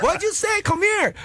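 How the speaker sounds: very high, sharp challenge. Retold or imitated. Angry neighborhood girl yelling at the catcaller, high and sharp. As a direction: snap back at him like a furious girl. Mean girl voiced in a story.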